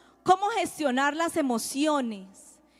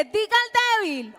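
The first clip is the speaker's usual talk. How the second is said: raised, emphatic preaching; preaching the verse; 6 semitones higher, 3 dB louder than usual. Acted. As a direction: proclaim the verse with conviction. Predica el versículo. (predicando con fuerza)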